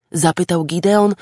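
ordinary delivery, nothing marked

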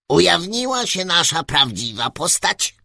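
very high, loud squeak through a megaphone; squeaky little voice. Acted. Tiny ruler greets the travellers through a megaphone, very high squeaky voice. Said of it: "odparł głos przez megafon".

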